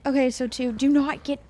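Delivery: normal talking voice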